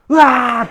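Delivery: loud, high distant-victim scream; mimicked scream. Teller imitating a scream from the woods, loud. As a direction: let out a sudden high scream. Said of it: "비명 소리가 들려".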